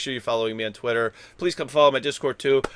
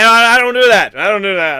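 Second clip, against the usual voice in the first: emphatic; 7 semitones higher, 10 dB louder than usual. Real, not acted.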